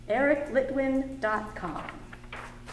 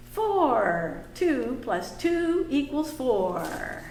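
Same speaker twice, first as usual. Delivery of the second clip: put-on reading to children. Teacher reading a picture book aloud to the class.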